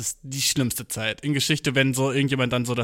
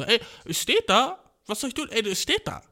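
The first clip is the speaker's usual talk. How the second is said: mock innocent excuse; 8 semitones higher than usual. Classmates excusing reading the word.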